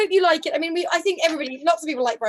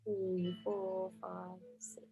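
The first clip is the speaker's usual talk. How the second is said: much softer counting; soft fading count; 6 semitones lower, 15 dB quieter, much slower than usual. Shown as a demonstration. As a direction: keep the count very soft as it fades. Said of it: "We're fading away".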